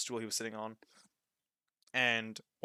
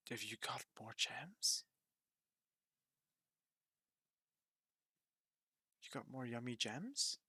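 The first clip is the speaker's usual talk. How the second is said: greedy whisper; 11 dB quieter than usual. Acted. Roka, drooling, asks about gems in a whisper. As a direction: lean in and whisper with greedy longing. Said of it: "and he's like".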